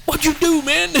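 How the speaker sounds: high, loud laughing protest; mock-yell at a workmate who blundered; retold indignant yell. To Dean after the plank fell, mock-indignant yell.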